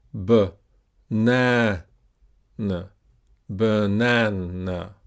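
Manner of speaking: banana broken up with pauses, then whole and drawn out, middle longest; b, na, n apart, then a slow banana; syllable by syllable. Shown as a demonstration. Teacher breaking down the word, slow banana with long stressed middle. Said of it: "is the stressed syllable".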